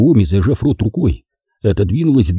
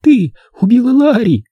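high, louder stunned accusation; childlike accusation; 14 semitones higher, 3 dB louder than usual. Acted. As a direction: accuse her like a shocked child. Toni accusing Naomi.

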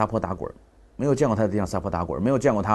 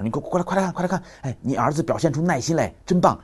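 higher, eager call to come look; excited calling; 7 semitones higher than usual. Retold or imitated. Father calling wife to see their son, excited. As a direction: call her over, thrilled.

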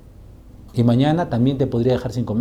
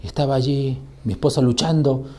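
ordinary talk, nothing marked

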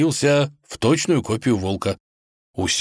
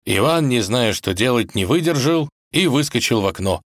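usual speaking voice

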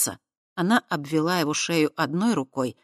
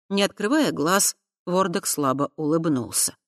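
ordinary delivery, nothing marked

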